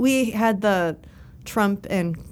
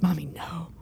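put-on whispered little kid plea; scared child whisper; 2 semitones lower, 5 dB quieter than usual. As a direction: whisper like a frightened boy. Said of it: "and he was like".

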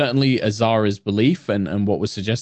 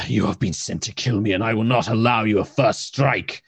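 hostile celestial. Acted. Celestial accuses party and turns hostile.

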